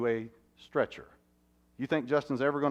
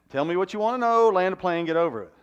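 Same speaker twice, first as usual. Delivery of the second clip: louder, higher blunt guy who wants the point now; impatient man; 8 semitones higher, 10 dB louder than usual. Acted. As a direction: hurry her to the point, loud and impatient. Men wanting to land the plane.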